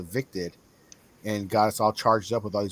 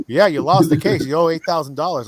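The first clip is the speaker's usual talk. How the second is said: raised, loud imitation of the lawyer; mock lawyer; 5 semitones higher, 10 dB louder than usual. Lawyer calling client about lost case, mock loud delivery. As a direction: play the lawyer blurting it out, high and loud.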